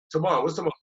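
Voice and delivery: ordinary talk, nothing marked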